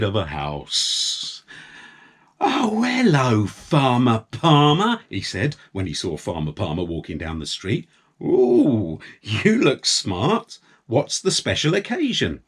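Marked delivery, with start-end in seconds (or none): 2.4-5.0 high, slow and friendly greeting; cheery painter. A narrator's reading. Painter greeting the farmer, cheery. 8.2-12.4 high, drawn-out mock-impressed; teasing admiration. A narrator's reading. Painter admiring the smart clothes.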